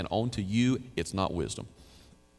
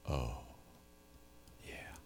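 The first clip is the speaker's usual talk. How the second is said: low, soft murmur of realization; hushed group reaction; 9 semitones lower, 17 dB quieter than usual. Retold or imitated. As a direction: let it sink in, very softly. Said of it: "everybody in the room went".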